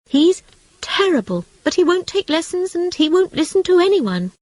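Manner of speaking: high, fretful rat voice; worried rat. A narrator's reading. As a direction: complain about Toad, pitched high.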